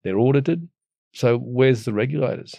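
normal talking voice

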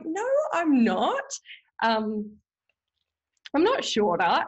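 high, slow mock denial; indignant denial. Wife denying to husband she is shorter, mock indignant. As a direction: refuse it, half offended.

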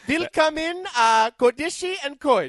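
high, loud hammed-up German welcome; co-host welcomes callers with a hammed-up German accent; mock German accent. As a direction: boom a German greeting like an announcer. Greets show in German voice.

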